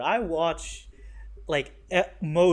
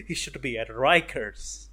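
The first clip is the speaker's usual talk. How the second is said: words spoken through a laugh, louder; 3 dB louder than usual. Real, not acted. Reviewer mocking the Punisher scene, laughing. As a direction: chuckle while you make the point.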